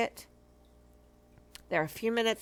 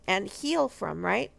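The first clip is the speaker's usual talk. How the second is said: ordinary talk, nothing marked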